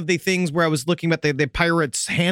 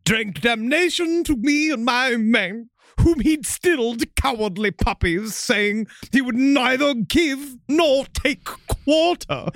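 high, slow, haughty officer recounting an insult; hammed-up pompous British captain, stretched vowels; Captain Maynard; 7 semitones higher, slower than usual. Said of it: "From what Captain Maynard remembered".

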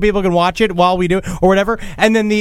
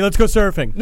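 laughing. Acted. Repeated joke, laughing.

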